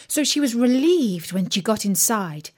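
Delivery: usual speaking voice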